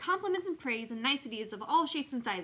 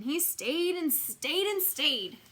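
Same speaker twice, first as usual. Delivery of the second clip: slow, stretched, each stayed dragged out; drawn out; 6 semitones higher, 4 dB louder, much slower than usual. A narrator's reading. Narrator stretching out the endless stay, slow and drawn. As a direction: let the words drag on and on.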